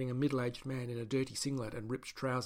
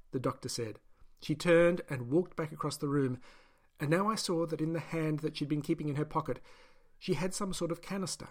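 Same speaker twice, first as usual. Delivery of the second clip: plain reading voice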